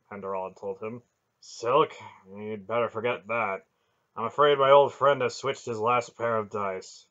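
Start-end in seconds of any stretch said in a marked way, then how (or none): none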